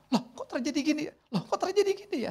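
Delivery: high, startled loh kok; startled surprise. Acted. Preacher voicing a startled, unaware person, high surprise. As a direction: act caught off guard, voice jumping up.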